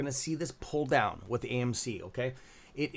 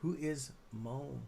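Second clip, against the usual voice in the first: usual speaking voice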